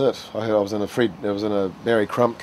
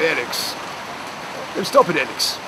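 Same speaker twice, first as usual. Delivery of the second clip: higher, coy mock protest; Pete's voice; 6 semitones higher than usual. Old actor Pete telling Alex to stop, high and coy. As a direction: swat him away with a giggly voice. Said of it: "That was his voice, like that".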